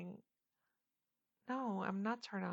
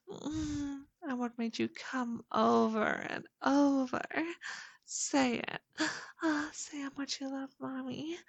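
sweet nothings; 7 semitones higher, 13 dB louder than usual. Acted. Intimate endearments at climax.

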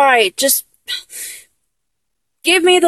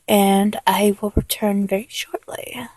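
teasing whisper; 6 semitones lower, 6 dB quieter than usual. Acted. Leaving the partner for props, whispered tease. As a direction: whisper it as a playful threat.

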